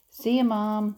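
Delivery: put-on boy shouting goodbye to mom; yelled. A narrator's reading. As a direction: call it out as you run. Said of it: "Jasper yelled".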